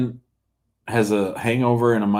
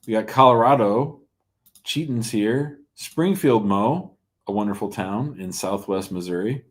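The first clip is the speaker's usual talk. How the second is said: usual speaking voice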